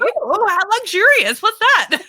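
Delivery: mock posh. Joining the posh joke.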